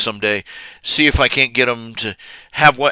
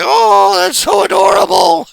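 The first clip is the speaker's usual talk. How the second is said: much higher gushing coo; 6 dB louder than usual. Retold or imitated. Ashley reacting to the puppy voice, high gushing coo. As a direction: gush over something adorable. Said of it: "And Ashley's like".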